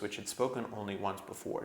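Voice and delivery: usual speaking voice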